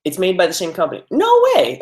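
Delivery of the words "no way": high-pitched disbelieving exclamation; amazed. Real, not acted. Streamer learns from chat it's the same company, amazed. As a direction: burst out high in delighted disbelief.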